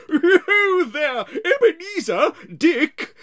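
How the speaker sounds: loud, high, rich jovial call; a hearty old master calling his apprentices; jovial fat voice. Acted. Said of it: "comfortable, oily, rich, fat, jovial voice".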